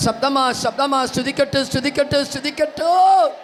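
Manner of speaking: high, loud revival shouting; full-force preaching. Real, not acted. Preacher urging congregation to praise aloud, shouted at full force. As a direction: rouse the crowd with ringing, high-pitched cries.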